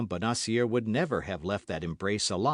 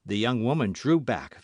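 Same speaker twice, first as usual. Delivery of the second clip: plain reading voice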